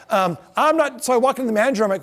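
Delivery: ordinary talk, nothing marked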